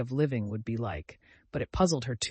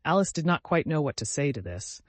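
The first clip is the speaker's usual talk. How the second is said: ordinary delivery, nothing marked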